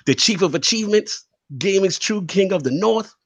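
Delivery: ordinary talk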